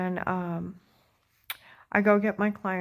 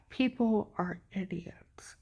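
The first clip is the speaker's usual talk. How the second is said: slow contemptuous whisper; whispered scorn; 6 dB quieter, slower than usual. Real, not acted. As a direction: breathe it out slowly with disdain. Disgusted aside after a sigh.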